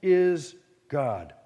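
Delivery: slow, weighty declaration; confident affirmation. Acted. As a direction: declare it firmly, word by word. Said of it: "very strong statements of great confidence".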